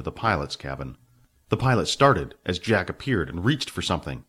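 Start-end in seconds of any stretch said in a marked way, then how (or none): none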